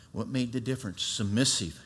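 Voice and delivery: ordinary delivery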